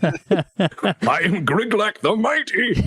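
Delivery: booming mock-heroic self-introduction, slow and high; slow, laughing hammed-up warrior boast; grand proclamation. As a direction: announce yourself like a legendary hero. Player proclaims character's grand title.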